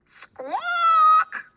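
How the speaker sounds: very high, loud bird squawk; startling squawk behind Hannah; humongous squawk. A narrator's reading. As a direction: screech it out like a huge bird. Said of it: "a humongous squawk".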